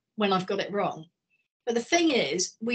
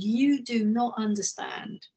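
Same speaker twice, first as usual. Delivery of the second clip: slow emphatic quote; slower than usual. Retold or imitated. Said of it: "He said".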